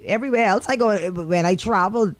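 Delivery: ordinary delivery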